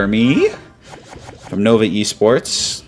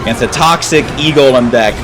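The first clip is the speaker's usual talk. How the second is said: mocking laugh at the end; 4 semitones higher than usual. Real, not acted. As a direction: laugh at the deck, higher pitched. Mocking the opponent's deck.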